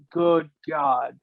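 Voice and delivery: normal talking voice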